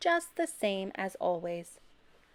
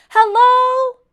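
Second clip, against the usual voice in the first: very high, loud worried shout; desperate loud shout; 19 dB louder than usual. A narrator's reading. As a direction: shout for her. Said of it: "Abel shouted".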